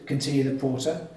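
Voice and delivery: ordinary delivery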